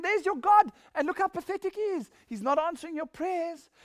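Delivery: high, taunting jeer at the pagan god; mocking taunt. Elijah taunting the prophets of Baal, high mocking jeer. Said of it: "See how he's mocking them".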